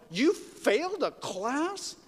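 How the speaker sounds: high-pitched, drawn-out disbelief; incredulous question. Acted. Interviewer questioning Churchill about his schooling, incredulous. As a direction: slowly ask it as if astonished, pitch lifted.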